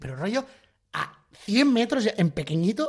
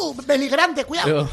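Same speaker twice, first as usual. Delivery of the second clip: mock safety warning; 7 semitones higher, 5 dB louder than usual. Mock safety officer scolding worker.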